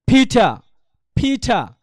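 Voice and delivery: "ফিটা" with a puff on the P and no final R; aspirated, R dropped. Shown as a demonstration. Teacher models Peter without the R, aspirated "ফিটা". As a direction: breathe out hard on the first sound of "ফিটা", end on an open "aa". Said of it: "এ লাস্টে আর উচ্চারণ হবে না".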